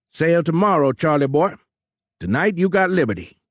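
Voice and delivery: high, loud Cajun captain; big, high-pitched Cajun voice; thick Cajun accent. A narrator's reading. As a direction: hand out orders heartily in Cajun. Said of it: "in a thick Cajun accent".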